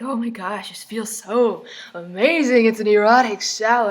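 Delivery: drawn-out, swooning mock bliss; mock rapture. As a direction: gush as if swept away, hammed-up. Voicing the book heroine's shower fantasy.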